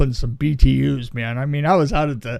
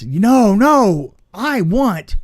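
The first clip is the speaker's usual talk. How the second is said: higher, louder indignant protest; incensed director; 7 semitones higher, 5 dB louder, slower than usual. Retold or imitated. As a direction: stamp your foot like a thwarted director. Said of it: "jumped up and down like he was incensed".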